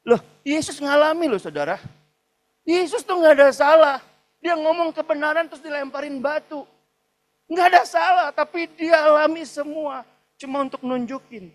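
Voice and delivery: high, loud impassioned preaching; full-force preaching. Acted. Pastor drives home Jesus' suffering to the congregation, impassioned shout. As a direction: preach it with fire, rising to a climax.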